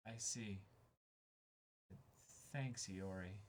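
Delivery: hushed, touched thank-you; quiet, grateful answer; soft reply. Acted. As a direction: say it softly, barely above a murmur. Voicing the manga reply.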